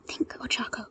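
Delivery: hushed self-urging; whispered to herself. Acted. Ochako urging herself to think, whispering. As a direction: whisper a reminder to yourself. Self-talk under pressure.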